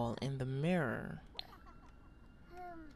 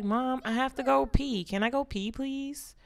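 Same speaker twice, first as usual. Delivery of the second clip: put-on toddler voice; 7 semitones higher than usual. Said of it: "She like".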